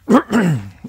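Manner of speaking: loud clearing of the throat. Real, not acted. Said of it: "Excusez-moi".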